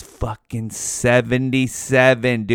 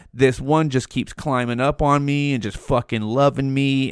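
ordinary delivery

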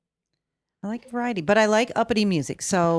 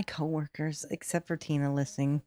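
whisper; 4 semitones lower, 8 dB quieter than usual. Real, not acted. Hushed about coworkers.

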